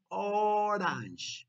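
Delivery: slow, drawn-out teacher's "orange"; model for repeating. Shown as a demonstration. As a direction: stretch "orange" for children to echo. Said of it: "repeat after me".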